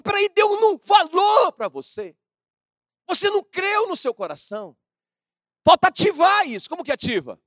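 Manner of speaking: high, loud preaching cry; preaching shout. Real, not acted. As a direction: call it out hard to the church. Pastor rebukes murmuring believers. (pregação aos gritos, bem alto)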